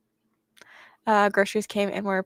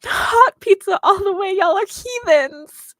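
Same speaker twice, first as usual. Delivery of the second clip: mock outrage; 11 semitones higher, 8 dB louder, slower than usual. Mock outrage at pizza take.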